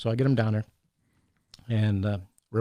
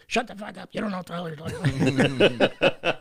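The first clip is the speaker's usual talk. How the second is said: mock Vinny, higher and gruff, with a laugh; Vinny's rough retort; 7 semitones higher than usual. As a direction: do a playful impression of a friend telling someone off. Vinny scolds the assistant, retold.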